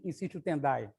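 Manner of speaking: ordinary delivery, nothing marked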